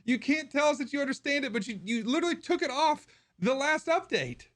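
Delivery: high-pitched, worked-up complaint; heated rant. Real, not acted. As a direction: let the frustration spill over.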